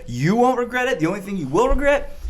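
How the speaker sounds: high, loud, laughing hype; excited cheering. Real, not acted. Cheering on the all-nighter plan.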